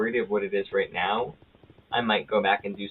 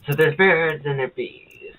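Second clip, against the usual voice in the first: mock lecture; 6 semitones higher, 7 dB louder, slower than usual. Mock birds and bees talk.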